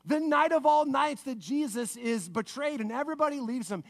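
ordinary delivery

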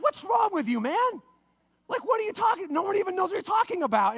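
high-pitched mock exasperation; exasperated scolding. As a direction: scold a friend in disbelief. Disciples scold Peter, acted.

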